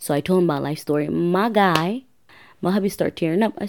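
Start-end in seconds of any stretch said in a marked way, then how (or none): none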